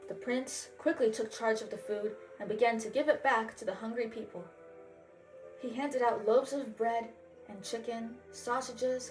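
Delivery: plain reading voice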